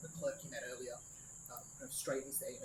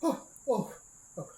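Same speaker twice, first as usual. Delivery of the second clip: flustered gasps; 10 semitones higher, 11 dB louder than usual. Acted. Clerk flustered after the outburst.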